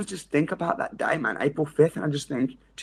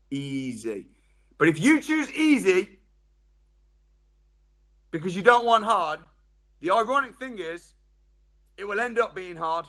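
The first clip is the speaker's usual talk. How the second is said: ordinary talk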